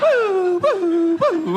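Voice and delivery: high, loud laughing woo-woo-woo; Glass Joe woo. Retold or imitated. Comic imitating the Punch-Out crowd, with a high laughing woo. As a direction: squeal high like a cartoon boxer going down. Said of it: "He fell like Glass Joe".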